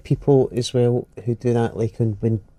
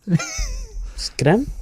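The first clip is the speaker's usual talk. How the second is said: "It's grim" squeaked much higher; mock grim. Real, not acted. Repeated, first take marked.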